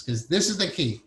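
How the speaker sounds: usual speaking voice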